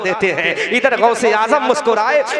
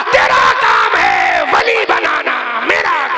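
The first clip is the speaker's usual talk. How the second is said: calling out; 8 semitones higher, 4 dB louder than usual. A narrator's reading. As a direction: proclaim it like a saint calling across the house. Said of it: "Shahbaz Lamkani ने आवाज लगा दी".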